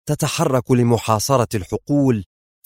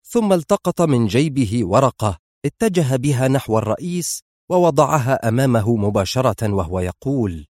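ordinary delivery

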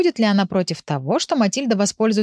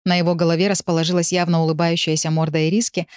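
plain reading voice

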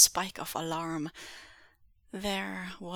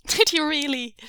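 gleeful mockery; 8 semitones higher, 8 dB louder than usual.

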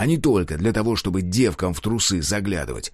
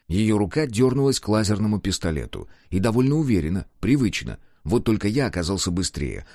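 ordinary delivery

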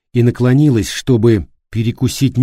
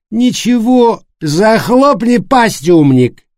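put-on loud drunken shout, higher; 12 semitones higher, 6 dB louder, slower than usual. A narrator's reading. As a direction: yell it at him. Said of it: "крикнул на него Флориан". (крик)

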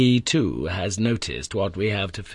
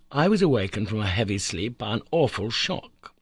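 ordinary delivery

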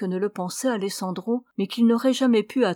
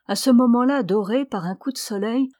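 plain reading voice

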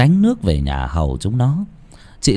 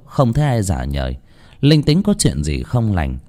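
plain reading voice

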